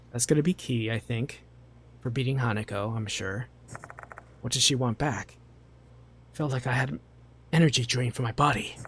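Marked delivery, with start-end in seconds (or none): none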